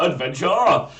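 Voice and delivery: loud, high shout; excited cheer. Acted. Narrator echoes the listener's cheer, loud and excited. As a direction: echo the cheer triumphantly.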